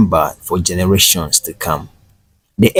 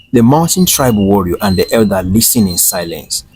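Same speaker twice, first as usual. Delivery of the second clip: ordinary delivery, nothing marked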